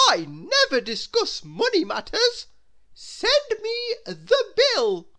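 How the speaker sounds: high, loud, slow lofty command; haughty grand lady. Acted. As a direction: dismiss money like royalty. Miss Splendid haughty.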